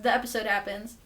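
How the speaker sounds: usual speaking voice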